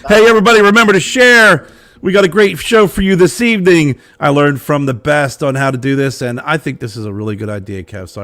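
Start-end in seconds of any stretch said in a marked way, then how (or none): 0.1-4.0 hosting voice, higher and louder; show host intro. Acted. Opening the live show to viewers, upbeat announcer read. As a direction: pump up the audience like a live host.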